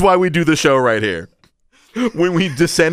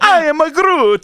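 Vin Diesel; 9 semitones higher, 3 dB louder than usual. Acted. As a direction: belt it out in a silly Italian accent. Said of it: "Give me Vin Diesel".

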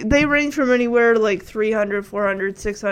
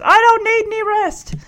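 grumpy grandfather; 10 semitones higher than usual. Retold or imitated. Grandfather retorts to doctor.

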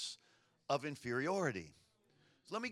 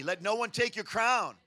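loud call line; 4 semitones higher, 6 dB louder than usual. Shown as a demonstration. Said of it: "repeat it back".

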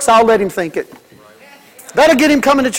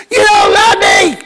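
put-on high, slow child's wail, louder; 10 semitones higher, 4 dB louder, slower than usual. Kid shouting at parents who refuse, high loud wail. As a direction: wail it like a kid throwing a tantrum.

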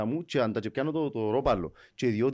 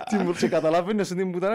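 ordinary talk

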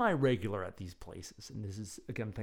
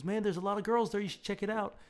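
mock pushy salesman pitch, higher and louder; imitating a friend hamming up the pitch; overselling it; 11 semitones higher, 3 dB louder than usual. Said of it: "he was overselling that part".

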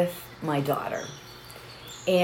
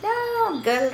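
high, drawn-out reassuring no; brushing off help; 13 semitones higher than usual. Retold or imitated. Customer to worried store helper, high-pitched breezy refusal. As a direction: wave the fuss away in a much higher voice. Waves off offered help, acted.

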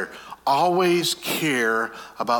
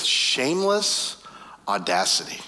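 1 slow emphasis; 3 semitones lower, slower than usual. Shown as a demonstration. Preacher slows on key phrase.

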